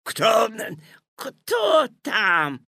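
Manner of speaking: loud, booming wolf call. A narrator's reading. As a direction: boom it from the next room. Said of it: "пробасил из комнаты волк".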